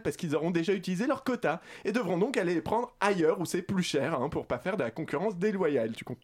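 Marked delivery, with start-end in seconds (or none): 5.9-6.2 choking mid-sentence; coughing, struggling for breath; choking on a drink. Real, not acted. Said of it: "je m'étouffe".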